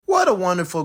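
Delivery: plain reading voice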